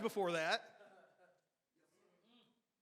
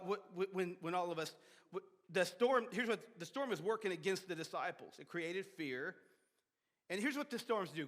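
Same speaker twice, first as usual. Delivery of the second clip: ordinary talk, nothing marked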